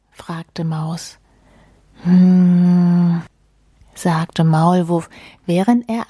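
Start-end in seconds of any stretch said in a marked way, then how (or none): 2.0-3.2 long, loud "hmm"; savouring hum. Acted. Mole asked how the hammock is, drawn-out hum. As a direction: draw out a steady, considering hum.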